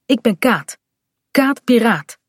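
a touch of tough pirate swagger; as tough as she can. Acted. Kaat introducing herself to the new boy, trying to sound tough. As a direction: say your name like a bold pirate. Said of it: "zo stoer als ze kan".

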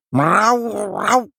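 high drawn-out cat yowl; loud, insistent meowing; long meowing tale. A narrator's reading. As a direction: meow at length like a cat telling news. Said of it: "Weer een lang mauwend verhaal".

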